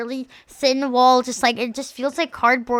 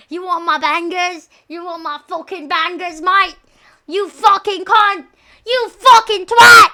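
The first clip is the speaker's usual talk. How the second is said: put-on higher, louder British-accent rant building to a slow shout; mock British screaming; 6 semitones higher, 9 dB louder than usual. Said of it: "I'll be quiet now because I, literally scream".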